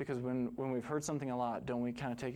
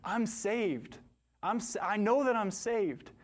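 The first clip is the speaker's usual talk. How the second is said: higher, louder, overcome with emotion; shaking cry of joy; 8 semitones higher, 5 dB louder, slower than usual. Retold or imitated. Dying man, weeping over John 3:16, cries out higher and louder. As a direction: cry it out as if suddenly saved. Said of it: "he's shaking uncontrollably, and he's saying".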